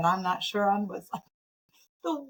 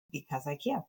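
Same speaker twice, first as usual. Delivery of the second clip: soft, low, matter-of-fact "Because I can"; simple quiet reply; 4 semitones lower, 8 dB quieter than usual. Retold or imitated. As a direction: say "Because I can" quietly, lower and softer, as a calm final answer. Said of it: "this woman responded with".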